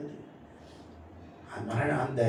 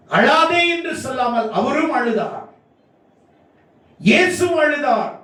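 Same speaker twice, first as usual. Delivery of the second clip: high, raised proclamation; emphatic preaching; 10 semitones higher than usual. Real, not acted. Preacher tells the congregation Jesus wept too, emphatic preaching. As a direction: lift the voice high on each statement.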